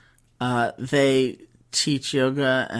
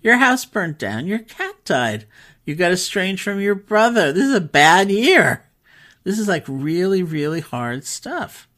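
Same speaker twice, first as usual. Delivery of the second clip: much higher and louder, emphatic and sympathetic; high, loud sympathetic exclamation, slowing at the end; retold emphatic sympathy; 7 semitones higher, 7 dB louder than usual. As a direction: burst out in disbelief at her awful year. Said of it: "And I said".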